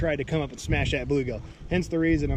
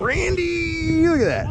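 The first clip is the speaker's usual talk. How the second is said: excited; 13 semitones higher, slower than usual. Real, not acted. Shows buddy the fish.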